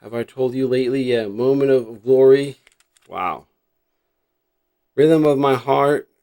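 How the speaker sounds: ordinary talk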